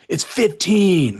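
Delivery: whiny patient. Retold or imitated. Doctor mocking a patient, hammed-up whine. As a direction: stretch it out like a hammed-up sufferer. Patient's exaggerated pain answer.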